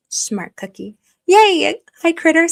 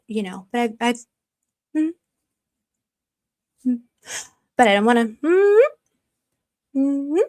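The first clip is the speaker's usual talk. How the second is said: ordinary talk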